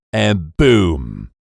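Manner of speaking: ordinary delivery, nothing marked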